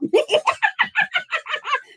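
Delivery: high mocking laugh; real amused laughter. Real, not acted. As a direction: cackle at the angry caller. Laughing at angry caller.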